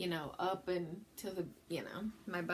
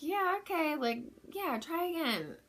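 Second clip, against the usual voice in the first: much higher, sing-song coaxing midwife; mimicking the midwife; 9 semitones higher than usual. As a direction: chirp it like a cheery coach. Midwife cheering her on.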